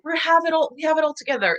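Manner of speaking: high, sing-song mock boast; mock have-it-all-together. Mocking the image that we're all perfect, high-pitched mock smugness. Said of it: "we have this image of, like".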